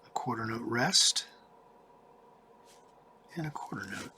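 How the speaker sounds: unvoiced whisper aside. Shown as a demonstration. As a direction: whisper it to yourself. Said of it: "whispered aside".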